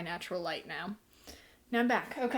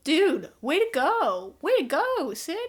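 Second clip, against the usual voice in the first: loud, much higher, slow mock praise; sarcastic cheer; 8 semitones higher, 9 dB louder than usual. Reviewer mocking the character Sid, loud sarcastic cheer. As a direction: yell your sarcastic congratulations. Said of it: "And I was like".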